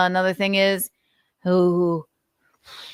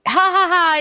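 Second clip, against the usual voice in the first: fake laugh; 11 semitones higher, 5 dB louder than usual. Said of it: "It's so funny".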